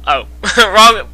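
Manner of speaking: plain reading voice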